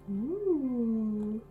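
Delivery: ordinary delivery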